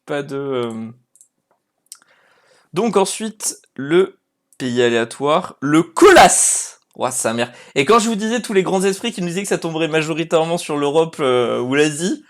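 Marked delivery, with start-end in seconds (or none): 5.6-6.8 loud, higher, drawn-out reveal; loud announcement. Real, not acted. Streamer announcing the random country, loud drawn-out reveal. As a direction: call out the draw, slow and loud.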